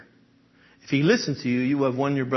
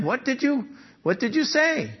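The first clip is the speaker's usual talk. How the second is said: ordinary delivery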